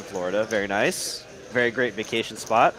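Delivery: normal talking voice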